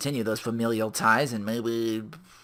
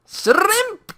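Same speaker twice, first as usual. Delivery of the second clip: shouted punchline; 11 dB louder than usual. Acted. Joke punchline repeated.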